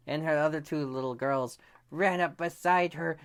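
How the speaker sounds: ordinary delivery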